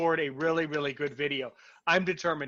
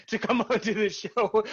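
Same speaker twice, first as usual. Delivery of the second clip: breaking into laughter; 5 semitones higher than usual. Real, not acted. Host cracks up at own wording.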